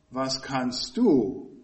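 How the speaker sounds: "was kannst du" slowed, "du" stressed and falling. Shown as a demonstration. Preacher contrasting the stress for the congregation, firm on "du". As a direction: land firmly on "du". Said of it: "Er verlegt die Betonung auf".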